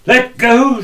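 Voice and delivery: high, loud shout of let go; shouting old woman. Acted. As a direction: yell at the clinging crowd to let go. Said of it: "She began to kick and shout".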